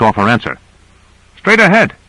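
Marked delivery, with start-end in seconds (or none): 1.4-1.9 sharp high call ahead. A narrator's reading. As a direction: call out the warning up front. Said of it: "he called".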